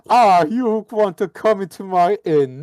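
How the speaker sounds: mock the innkeeper's spooky accent; Dracula-like innkeeper. Player imitating the motel owner's Dracula voice. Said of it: "Why does he talk like".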